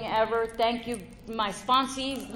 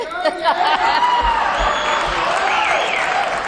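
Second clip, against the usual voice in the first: very high, loud peal of laughter; big laugh; 9 dB louder than usual. Real, not acted. Joking to the women about sex life, big high laugh. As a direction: burst out laughing.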